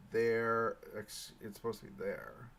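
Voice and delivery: ordinary delivery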